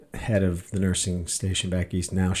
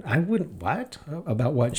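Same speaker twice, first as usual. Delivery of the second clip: sharply higher, confused what; surprised; 8 semitones higher than usual. Retold or imitated. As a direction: caught off guard, pitch jumps up. Caught off guard by accusation.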